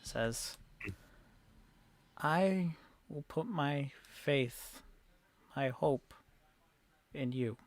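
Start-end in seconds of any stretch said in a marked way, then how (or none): 2.2-7.6 soft, halting plea; earnest pleading townswoman. Acted. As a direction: entrust them quietly, pausing between phrases.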